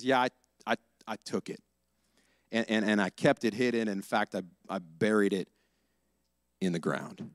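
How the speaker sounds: soft, low, ashamed admission; quiet confession. Acted. Thief confessing to Joshua, quiet and ashamed. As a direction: let the guilt sink the voice. Achan confessing hidden theft.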